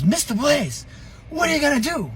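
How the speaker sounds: high-pitched, worked-up kid impression; excited friend. Friend telling father his car got smashed, excited high voice.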